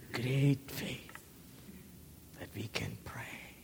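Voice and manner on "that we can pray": much softer, breathy; prayerful hush; whisper. Real, not acted. Preacher praying for the congregation, whisper.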